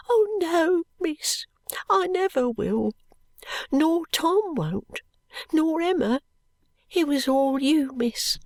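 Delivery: earnest and tearful; grateful little girl. A narrator's reading.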